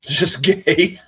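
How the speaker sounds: loud, high, through laughter; laughing. Real, not acted. Streamer cracking up at a silly joke, laughing. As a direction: crack up as you say it.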